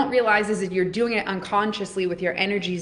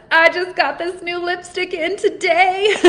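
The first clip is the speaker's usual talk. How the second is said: rising into a giggle, higher and louder; giggly; 9 semitones higher, 6 dB louder, slower than usual. Real, not acted. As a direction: let the laugh break in as you talk about the new lipstick. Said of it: "it makes me giggle".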